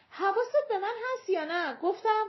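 high, quick insistent demand; impatient. A narrator's reading. Woman checking he is listening, impatient. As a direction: press him to listen.

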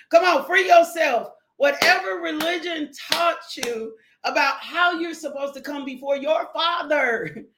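ordinary talk, nothing marked

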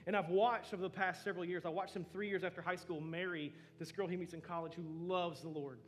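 loud, impassioned testimony, building as it goes; preaching at full force. Acted. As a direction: pour your heart into the story of Michael, full voice. Impassioned personal story in sermon.

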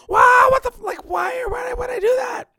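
very high, mock-freakout shout; squealed mock outburst; going crazy. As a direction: imitate her flipping out, stumbling over words. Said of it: "goes crazy after the tarot card reading".

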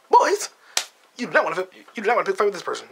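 high-pitched taunting villain warning; a badly done Lex Luthor, smug and shrill; Lex Luthor impression. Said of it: "I can't talk like that".

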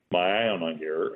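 usual speaking voice

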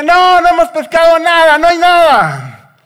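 high, loud holler with stretched endings; calling back. Retold or imitated. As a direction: shout the answer back across the water. Juan calls back to the shore.

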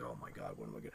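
very low, soft aside; hushed, sinking mumble; muttered dismay. Retold or imitated. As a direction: mutter it to yourself, retold. Retold private dismay amid commotion.

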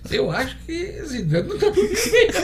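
high amused laugh through the line. Real, not acted. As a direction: say it while laughing. Jokes about Zidane, laughing.

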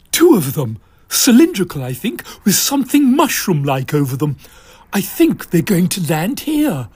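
lightly voiced lady spy peering intently; Mavis voice. Mavis describing the parachutists to Desmond, lightly voiced. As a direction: report it quickly and keenly.

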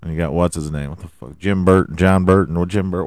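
ordinary delivery

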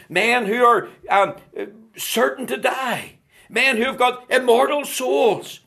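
high, forceful pulpit delivery; impassioned preaching. Real, not acted. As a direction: build with urgent, hammered phrases.

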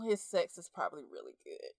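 low, hushed aside; private thought. Retold or imitated. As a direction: drop way down low and soft, as if thinking it. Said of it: "I honestly thought to myself".

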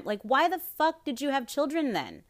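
high-pitched exasperated demand; real outrage, voice way up; outraged rant. Real, not acted. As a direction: fling the question at them in disgust. Ranting about abusive parents.

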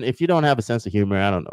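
normal talking voice

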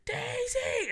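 put-on very high, squealed child's cry; excited little girl. Dad imitating his daughter spotting Daisy Duck, excited little girl. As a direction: imitate a small child calling out in delight.

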